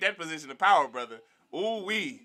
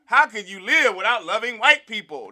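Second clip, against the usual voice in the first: high, loud mock incredulity; mock disbelief; 5 semitones higher, 7 dB louder than usual. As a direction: sound shocked he doesn't. Mocking the sellout's question.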